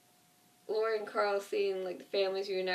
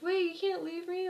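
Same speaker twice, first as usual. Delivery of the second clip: higher, desperate plea, imitating Merle; pleading; 9 semitones higher than usual. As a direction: mimic Merle begging not to be left behind. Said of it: "he's like".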